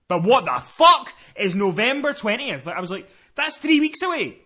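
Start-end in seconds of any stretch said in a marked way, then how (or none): 0.1-2.7 loud, high, slow disbelief; erupt, drawing the words out; exasperated outburst. Retold or imitated. Caller told of a late appointment, loudly exasperated. 3.4-4.4 high, loud complaint; incredulous protest. Retold or imitated. Caller protesting the three-week wait, high incredulous complaint.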